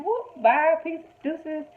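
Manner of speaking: high, sassy goodbye; mock Cookie. Cookie waving off Lucius, high and sassy. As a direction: wave him off in a high sing-song. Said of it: "She was just thinking".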